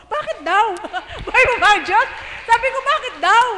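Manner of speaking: overjoyed cry with a laugh; excited disbelief. Retold or imitated. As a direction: relive the thrill of the news. Retelling joyful phone call.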